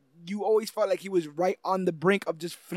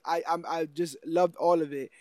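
ordinary talk, nothing marked

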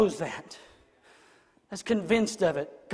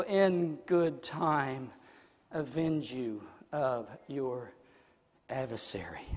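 very slow, low and soft; solemn promise; 6 semitones lower, 7 dB quieter, slower than usual. Real, not acted. Preacher promising God will avenge in good time, slow and hushed. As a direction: let each phrase settle gravely.

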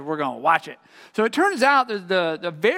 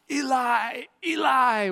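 higher, lightly acted cry; understated calling out; a touch of a drawn-out call; 7 semitones higher, slower than usual. A narrator's reading. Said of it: "he cries out".

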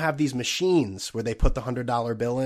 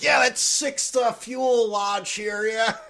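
much higher, slow mocking voice; mock Trump on tarmac; 8 semitones higher, slower than usual. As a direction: send up Trump checking a plane. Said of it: "being like".

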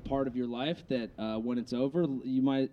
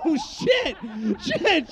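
loud laughing outburst; laughing exclamation; 13 semitones higher, 4 dB louder, much slower than usual. Real, not acted. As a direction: burst out laughing. Laughing reaction.

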